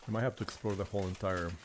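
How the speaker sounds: ordinary talk, nothing marked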